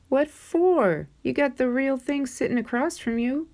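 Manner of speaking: a grandma waving off a silly wish; Nana's voice. Acted. Nana answers CJ's wish.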